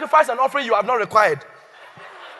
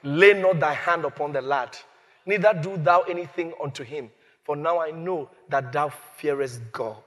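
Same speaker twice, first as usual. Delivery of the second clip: scripture voice; 5 semitones lower than usual. Acted. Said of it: "And he said".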